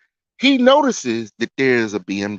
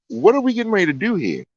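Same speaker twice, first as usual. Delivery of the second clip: high, quick puzzled question; retold bewilderment; 9 semitones higher, faster than usual. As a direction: imitate a baffled date asking what's going on. Retold puzzled question to his date.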